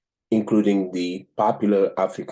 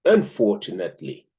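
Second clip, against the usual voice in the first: measured read-aloud with emphatic, lifted key words; 4 semitones higher than usual. Acted. Host reads a chapter on accents to listeners, deliberate read-aloud. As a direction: read it out deliberately, pausing between phrases.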